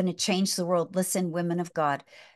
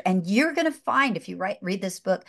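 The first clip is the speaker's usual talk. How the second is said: normal talking voice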